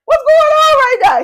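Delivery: high, loud, baffled cry; the little quarterback shouts in total confusion; bewildered quarterback. Retold or imitated. Little quarterback looking around, yelling in high bewilderment. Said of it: "The little quarterback is looking around like".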